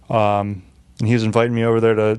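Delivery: ordinary talk, nothing marked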